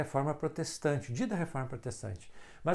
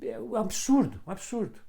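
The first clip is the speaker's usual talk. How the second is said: higher and louder, "é um absurdo" thrown out; indignant outburst; 8 semitones higher, 4 dB louder than usual. Real, not acted. Pastor decrying Halloween costumes, indignant. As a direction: cry out in disbelief.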